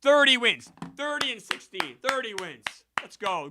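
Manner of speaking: high, loud triumphant call; excited cheer. Real, not acted. Streamer celebrating thirty wins on the season, excited cheering. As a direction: crow the win total proudly.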